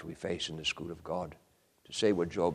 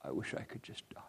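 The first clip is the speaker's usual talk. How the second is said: unvoiced, much softer whisper; 5 semitones lower, 14 dB quieter, faster than usual. Preacher quoting grieving parents, whispered. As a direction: let it fall to a hushed whisper. Parents' words repeated, fainter.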